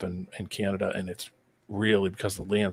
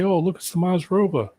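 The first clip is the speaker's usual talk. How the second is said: much higher, singsong mockery; mock wonder; 10 semitones higher than usual. Mimicking those who film in the middle of nowhere, mocking. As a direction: imitate fake awe, pitched way up. Mocks the makers announcing their film.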